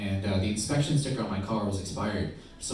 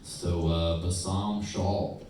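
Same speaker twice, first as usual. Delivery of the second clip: lower, slow, squinting over the name; slow cop voice; 4 semitones lower, 6 dB quieter, slower than usual. Retold or imitated. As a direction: drawl the name like a puzzled cop. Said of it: "the cop pulled out my license and goes".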